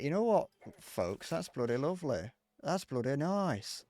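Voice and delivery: slow, drawn-out British voice doing a mock local; lightly put-on British accent, slow and pleased; British bloke impression. Streamer mimics a neighbourly British guy in chat, slow British accent. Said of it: "people talk like that".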